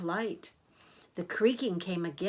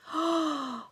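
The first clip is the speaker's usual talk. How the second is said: sharp, high intake of breath; shocked gasp; 7 semitones higher than usual. Acted. Reader gasps as thieves talk of killing, shocked gasp. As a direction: gasp in fright. Story turns frightening for Stanley.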